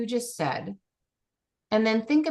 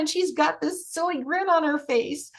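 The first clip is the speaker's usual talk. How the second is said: high, excited play-by-play of a little one; delighted narration; 7 semitones higher than usual. Shown as a demonstration. Coach describing the little girl running, delighted excitement. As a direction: relive it with delight.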